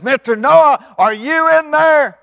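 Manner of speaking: high, drawn-out shout up to someone far off; slow, very loud, high call. Acted. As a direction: holler up at a closed ark. Daddy calls up to the ark.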